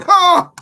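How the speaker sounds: a burst of high, loud laughter; laughing shriek. Real, not acted. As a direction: cracks up and yelps at the comment. Reacting to a viewer's joke.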